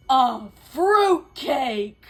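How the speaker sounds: ordinary delivery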